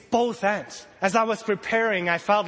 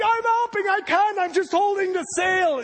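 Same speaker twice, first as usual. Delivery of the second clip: frantic reply; 10 semitones higher than usual. Retold or imitated. Disciple in the storm yelling back in panic. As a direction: shout back while struggling with the sail.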